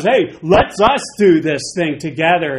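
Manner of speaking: loud, bossy buddy tone; a pushy pal calling God in; mock Moses. Moses roping God in, loud and bossy. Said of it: "He goes".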